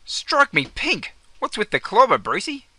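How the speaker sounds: astonished. Acted. Mate sees Brucey's outfit.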